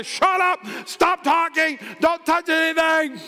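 high, mock angry orders in the car; mock snapping. Parent in the car after church, mock angry snapping. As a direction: bark at the kids, hammed-up. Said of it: "you say".

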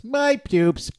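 My pubes squeaked up high; goofy high repeat of "My pubes"; high mock. Shown as a demonstration. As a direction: pitch "My pubes" way up in a silly squeak. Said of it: "repeats it pitched up".

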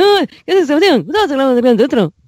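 high, loud jabbering imitation of a rural Uruguayan; mock Uruguayan speech. Wife imitating her Uruguayan brother-in-law, high and loud. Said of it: "Habla todo atravesado".

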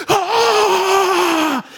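a huge high "ah" of a roaring crowd; stadium roar. Retold or imitated. Mimicking the stadium roar as he drives off, loud.

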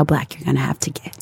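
soft whispered punchline; whispering. Real, not acted. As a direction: hush it like a secret.

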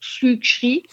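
slow, careful attempt at a tricky name; careful pronunciation. Real, not acted. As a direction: sound out the unfamiliar surname slowly. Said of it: "alors attention le nom".

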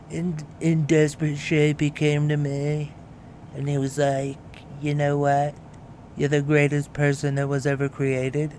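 a hint of Trump; lightly voiced Trump imitation; Trump impression. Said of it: "I hate my Trump voice".

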